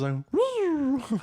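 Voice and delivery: high drawn-out wail. Retold or imitated. Mock exasperated wail.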